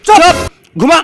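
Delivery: furious father shouting shut up; angry shout. Acted.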